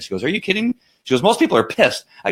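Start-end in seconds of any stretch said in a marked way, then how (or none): 0.2-0.7 high, incredulous clerk voice; surprised clerk. Retold or imitated. Clerk's reply voiced in story. 1.2-2.0 lightly raised clerk, amused; mock clerk voice. Clerk's reply voiced in story.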